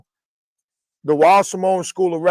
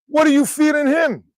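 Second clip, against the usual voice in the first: high-pitched question thrown right back; mock incredulity; 10 semitones higher than usual. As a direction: echo his disbelief, pitched way up.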